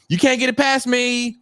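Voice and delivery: put-on high, cocky kid voice; little son taunting. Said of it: "he's like".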